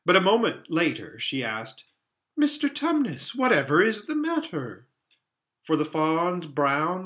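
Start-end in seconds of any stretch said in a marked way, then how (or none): 2.4-4.9 high-pitched, worried question; startled, anxious plea to a friend; alarmed concern. A narrator's reading. Lucy alarmed at faun's tears.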